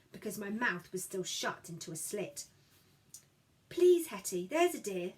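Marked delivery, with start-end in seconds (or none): none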